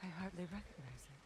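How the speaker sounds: hushed dismay. Acted. Seeing ruined home village.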